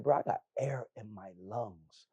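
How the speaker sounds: a hushed, unhurried "I got air in my lungs"; calm quiet conviction. Retold or imitated. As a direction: say it quietly like it's obvious. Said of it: "I'm like".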